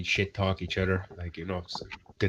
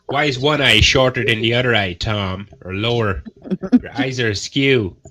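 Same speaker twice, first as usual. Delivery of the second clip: louder, acted-out taunting questions; pointed, raised-voice mock taunt; mock heckling; 2 semitones higher, 6 dB louder than usual. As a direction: call him out like a heckler. Acting out heckling Tom's looks.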